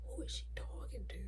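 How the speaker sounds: hushed, voiceless whisper; barely audible suspicious whisper; secretive whisper. Retold or imitated. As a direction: whisper it to yourself while eavesdropping. Eavesdropping, wondering to herself.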